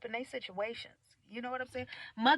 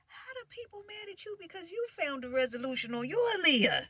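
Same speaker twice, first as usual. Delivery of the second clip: ordinary talk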